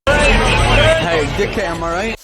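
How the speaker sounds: loud high shouting. Real, not acted. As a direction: yell it out. Shouted reaction to clip.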